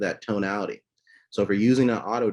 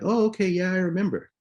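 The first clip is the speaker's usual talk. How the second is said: recognizing; 11 semitones higher than usual. Retold or imitated.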